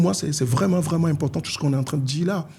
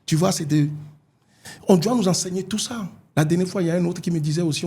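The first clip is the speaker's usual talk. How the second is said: usual speaking voice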